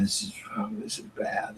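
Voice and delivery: breathy retold whisper; hushed dismay. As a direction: whisper it in mock dread. Retold dismay over baby ray.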